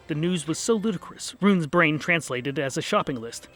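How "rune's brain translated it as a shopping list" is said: ordinary delivery, nothing marked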